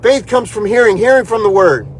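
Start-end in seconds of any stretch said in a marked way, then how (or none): none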